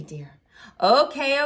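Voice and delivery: usual speaking voice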